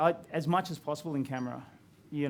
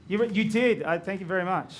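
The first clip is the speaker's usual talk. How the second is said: louder, higher excited thanks; 4 semitones higher, 7 dB louder than usual. Retold or imitated. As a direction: burst out with surprised gratitude. Excited reaction retold.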